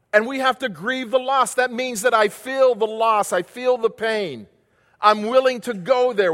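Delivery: high, loud, ringing sermon voice; full-force preaching. Acted. Pastor preaching forgiveness to his church, at full force. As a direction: preach it out to the congregation.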